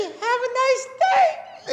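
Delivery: forced sweet "Have a nice day", slow and high; gritted-teeth politeness. Pretend. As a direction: smile through clenched teeth.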